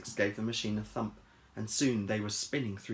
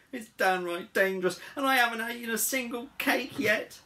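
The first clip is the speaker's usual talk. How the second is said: building higher and louder; fretful complaint; 12 semitones higher, 5 dB louder than usual. Acted. Travel-sick Harold moaning to his friend, rising exasperation. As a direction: whine with mounting grievance.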